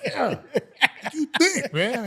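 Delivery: high, drawn-out bluff of confidence; feigned confidence. Retold or imitated. Player bluffing confidence to the weight coach, feigned confident and high. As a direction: imitate yourself putting on confidence, pitched high. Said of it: "I'm trying to look confident".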